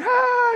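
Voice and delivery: very high delighted gasp; mock worship gasp. Member during worship, a very high delighted ah. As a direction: gasp like you're moved in worship.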